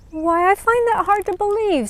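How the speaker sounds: high, lightly surprised Sister Rainbow; gently doubting the flower's words; a touch of kindly disbelief. Acted. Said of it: "said Sister Rainbow".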